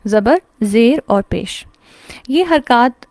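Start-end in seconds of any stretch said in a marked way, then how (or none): 0.0-1.7 slow, deliberate زبر، زیر اور پیش; slow, spelled out names. Shown as a demonstration. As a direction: drill the three names slowly. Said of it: "ہم میں سے الحمدللہ ہر ایک کو معلوم ہے".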